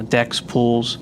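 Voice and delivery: usual speaking voice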